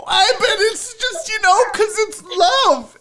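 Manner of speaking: high, squeaky mock-crying voice; hammed-up weepy high voice; tearful pleading. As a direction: plead as if blubbering over a TV romance. Imitates himself crying over the sitcom.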